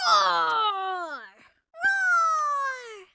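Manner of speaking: child's pretend dragon roar. Acting the dragon at dinner.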